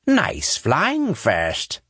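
slow, sardonic compliment; dry slow praise. A narrator's reading. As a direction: drawl it with mock approval. Indrat drily praises pilot.

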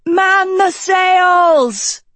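high, drawn-out shout over the storm; crying out. A narrator's reading. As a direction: yell the order into the wind. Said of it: "Agatha cried".

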